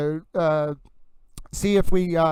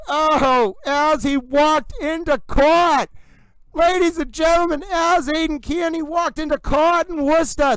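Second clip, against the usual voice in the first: high, drawn-out cries of astonishment; excited disbelief; 7 semitones higher, slower than usual. Real, not acted. Streamer reacts as Kearney walks into court uncovered, high-pitched excited disbelief. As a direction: wail the breaking news, pitched way up.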